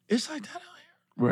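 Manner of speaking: hushed, high, slow question; discreet disbelief. Retold or imitated. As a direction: lean in and ask it under your breath, stretched out. Retold discreet second asking.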